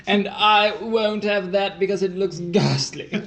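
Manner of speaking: mock snooty. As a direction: look down your nose at it, half-laughing. Mock posh rejecting item.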